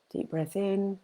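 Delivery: quiet breath cue, second round. Real, not acted. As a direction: breathe the words out gently. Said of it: "let's do that again".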